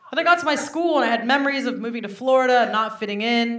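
ordinary talk, nothing marked

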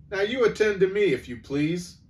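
high, loud pompous constable; officious policeman. Acted. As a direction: demand attention pompously. Policeman takes charge.

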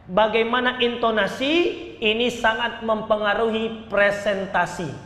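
normal talking voice

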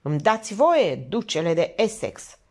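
plain reading voice

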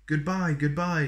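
high, loud and bright-eyed, as if saying hello; Mister Wrong greets her with the wrong word, beaming; cheery wrong greeting. A narrator's reading. Mister Wrong welcomes Little Miss Birthday with a hearty high goodbye. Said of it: "greeted Mister Wrong".

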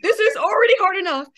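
high, loud, tearful shout at the nurse; pissed-off outburst. Retold or imitated. Mother scolding the crying nurse at her husband's deathbed, high tearful shout. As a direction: let rip at the sobbing nurse, voice breaking. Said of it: "a little kind of pissed off".

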